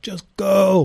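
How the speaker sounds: retold cheer pushing him forward; urging shout. Urging rider from start gate.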